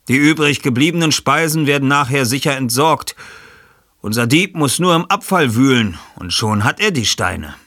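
erklärender Ermittler. Acted. Ich-Figur erklärt.

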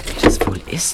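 hushed whisper while hiding. Acted. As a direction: breathe the question, no voice. Hiding from three strange men.

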